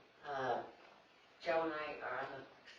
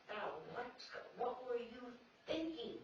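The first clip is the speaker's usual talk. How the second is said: exasperated demand; 8 semitones higher, slower than usual. Retold or imitated. Brother leans across desk, exasperated.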